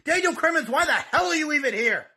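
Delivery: normal talking voice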